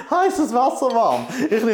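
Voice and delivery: words bubbling with laughter; laughing. Real, not acted. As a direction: say it while laughing at yourself. Laughs at own slip.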